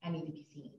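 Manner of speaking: hushed inner plea. Retold or imitated. Quoting her inner thoughts to the host, softly whispered. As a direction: whisper the plea to yourself, then hurry it.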